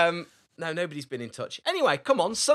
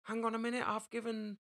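bad Irish accent; 8 semitones higher, 8 dB quieter, faster than usual. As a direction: protest in a hammed-up Irish accent. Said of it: "doing Irish wrong".

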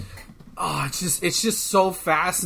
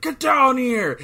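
much higher, louder yell down; angry shout; 9 semitones higher, 5 dB louder, slower than usual. Retold or imitated. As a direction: bark it up at someone above. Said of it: "and Scorpion's like".

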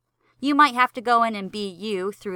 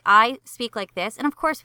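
ordinary talk, nothing marked

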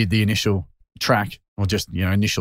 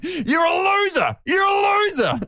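mock taunt. Mates mocking a beaten Dogs fan, in a high jeering chant. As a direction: jeer it like a gloating footy fan. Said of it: "who would just be sitting here going".

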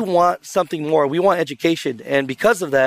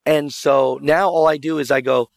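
normal talking voice

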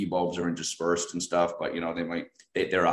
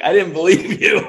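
laughing admission; 12 semitones higher, 11 dB louder than usual. Retold or imitated. Friend admits doubt.

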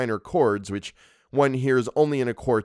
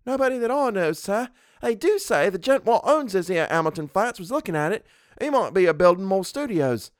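higher-pitched Cockney servant; Cockney dialect; 10 semitones higher than usual. A narrator's reading. Thomas answering his employer about the property, in Cockney dialect. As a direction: play a deferential Cockney lad. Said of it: "Thomas's Cockney dialect".

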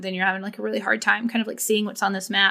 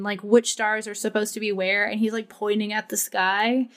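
ordinary talk

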